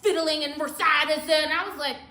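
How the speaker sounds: ordinary talk, nothing marked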